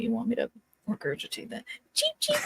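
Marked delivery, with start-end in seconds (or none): 1.9-2.4 very high cheeps; peeping baby chick. Acted. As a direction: chirp like a baby bird. Player imitates chicks.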